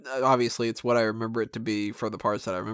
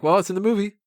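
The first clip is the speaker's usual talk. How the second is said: higher put-on voice; mock excuse; 9 semitones higher, 5 dB louder, faster than usual. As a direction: shrug it off in a silly voice. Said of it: "they'd be like".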